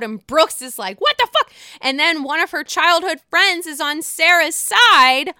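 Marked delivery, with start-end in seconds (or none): 1.0-1.5 much higher, louder mock outrage; imitating an outraged outburst. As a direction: explode like the Hulk in disbelief. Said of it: "the Incredible Hulk comes out".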